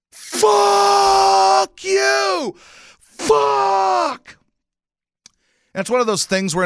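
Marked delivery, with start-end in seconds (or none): 0.1-4.4 long, high, full-force reenacted scream; visceral guttural yell. Fan reenacting his howl at a lost game, visceral yell. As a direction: let out a drawn-out roar of rage. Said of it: "let out this fucking visceral, guttural".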